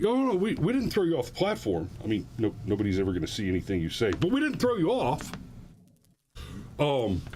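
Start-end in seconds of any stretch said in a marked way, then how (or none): 0.0-1.9 high, quick fake innocence; mock innocent. 4.1-5.3 high, quick fake innocence; mock innocent.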